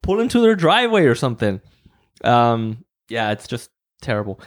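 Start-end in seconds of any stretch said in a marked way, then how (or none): 0.0-1.6 higher, irritated mock yell. Yelling at a driver double-parked to chat, irritated. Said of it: "And you're just like".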